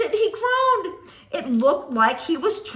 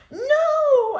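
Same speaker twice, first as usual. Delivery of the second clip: high frightened yell of no; 11 semitones higher, 6 dB louder than usual. Acted. Child yelling no at the monster as it approaches. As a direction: cry out in terror. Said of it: "I yelled".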